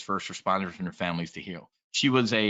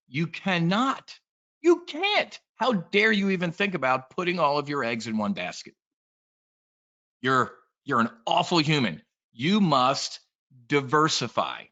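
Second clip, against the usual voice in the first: mock scolding advisor; 4 semitones higher than usual. Mocking the advisors who lie to new investors, with scolding outrage. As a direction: parody a preachy financial advisor.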